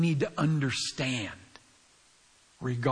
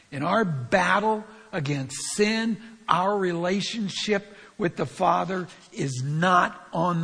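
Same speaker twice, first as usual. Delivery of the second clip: forceful preaching; 3 semitones higher, 6 dB louder than usual. Real, not acted. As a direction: proclaim it with full conviction. Preacher repeats point with force.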